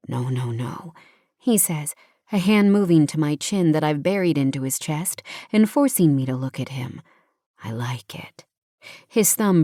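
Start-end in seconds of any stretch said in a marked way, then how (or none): 0.1-0.9 low, soft reassurance; lightly voiced tender man. A narrator's reading. Hero soothing crying heroine. 7.6-8.4 low, hushed reassurance; tender male lead. A narrator's reading. Hero reassures tearful heroine.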